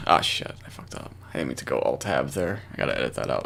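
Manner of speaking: whispered aside. Real, not acted. Muttering about an alt-tab mistake.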